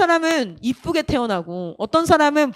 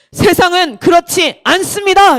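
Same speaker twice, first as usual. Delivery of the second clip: slow, loud preacher imitation; acted emphatic pulpit delivery; pastor's declaration; 3 semitones higher, 5 dB louder than usual. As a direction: weigh out each word for the congregation. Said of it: "이렇게 얘기하는데".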